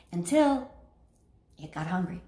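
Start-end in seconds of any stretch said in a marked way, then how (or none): 1.6-2.2 low, soft ominous punchline; ominous hush. Acted. Telling the dog story's dark ending, low and soft. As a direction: drop it low and quiet.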